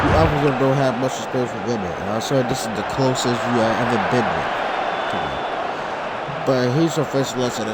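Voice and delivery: villain taunt. Acted. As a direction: sneer at her from low down.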